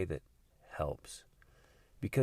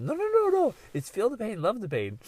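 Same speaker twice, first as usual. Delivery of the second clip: put-on high bossy child, much higher; five-year-old correcting; 13 semitones higher, 6 dB louder than usual. Five-year-old correcting her sister, high bossy kid voice. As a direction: correct her little sister like a know-it-all. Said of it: "the little five-year-old".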